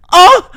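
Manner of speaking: high loud theatrical ah; hammed-up gasp; mock moan cry. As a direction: belt out the yelp for laughs. Reads involuntary moan in fanfic.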